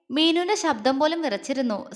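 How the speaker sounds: ordinary talk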